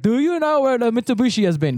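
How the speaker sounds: put-on high-pitched Indian-accented question; imitated Indian accent. Job seeker looking for Mitsubishi, imitated Indian accent. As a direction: play a distressed man with an Indian accent. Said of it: "I'll do the voice".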